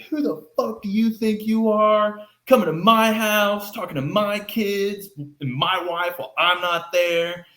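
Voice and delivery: stranger's dad confronting the door-to-door salesman, angry shouting; angry yelling dad. Retold or imitated. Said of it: "he just starts yelling at me".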